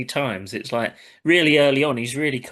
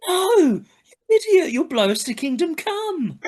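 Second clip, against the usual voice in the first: high, loud panicked yell; mock Rodney yell; 4 dB louder than usual. Rodney shrieking at Del not to blow them up. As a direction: squeal it out like a panicking younger brother.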